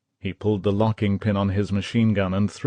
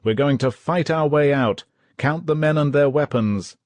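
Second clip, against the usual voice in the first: mild mock roar; 7 semitones higher, 4 dB louder than usual. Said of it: "roared the captain".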